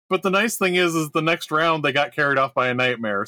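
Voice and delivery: ordinary delivery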